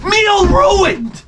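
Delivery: dramatic wail. Acted. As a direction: wail it like a tragedy. Dramatic despair.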